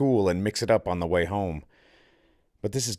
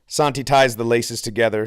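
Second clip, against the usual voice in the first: plain reading voice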